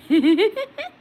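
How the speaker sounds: bright high burst of laughter; real laugh after the punchline. Real, not acted. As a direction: laugh out at your own story. Laughing at her own joke.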